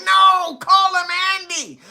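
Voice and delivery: high, stretched-out holler; mock insistent shout. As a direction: yell it like a crowd correcting someone. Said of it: "everybody around him like".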